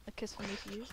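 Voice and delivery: soft teasing repeat. Pretend. Softer repeat to Alex.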